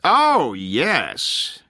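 loud, high "oh" swooping down; big sarcastic swoop. A narrator's reading. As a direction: agree with exaggerated mock enthusiasm. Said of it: "Corky said sarcastically".

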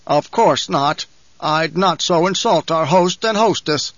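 stiff, frightened formality; stiffly. A narrator's reading. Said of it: "spoke stiffly".

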